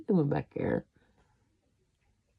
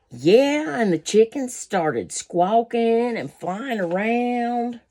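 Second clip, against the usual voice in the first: higher, excited retelling of the chaos; eager, raised-pitch storytelling; excited telling; 6 semitones higher, 4 dB louder, slower than usual. Acted.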